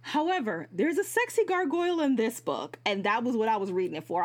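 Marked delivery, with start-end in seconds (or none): 0.0-2.8 excited buildup, sexy gargoyle; teasing excited reveal. Real, not acted. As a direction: pitch jumps higher on sexy gargoyle.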